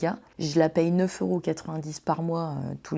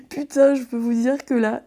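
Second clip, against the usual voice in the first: laughing; 6 semitones higher, 7 dB louder than usual. Real, not acted. Still laughing after the outburst.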